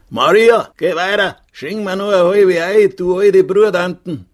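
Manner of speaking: high-pitched shout from a regular; loud calling. A narrator's reading. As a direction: holler across the tavern for beer. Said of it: "ein Stammgast laut gerufen hat". (laut und hoch durchs Wirtshaus rufen)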